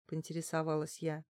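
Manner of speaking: ordinary delivery, nothing marked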